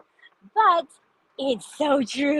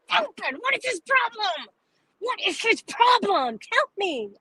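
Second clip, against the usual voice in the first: high-pitched incredulous rant; amused exasperation; 10 semitones higher than usual. Real, not acted. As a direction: plead in high, laughing disbelief. Laughing, exasperated rant about Rick Krug.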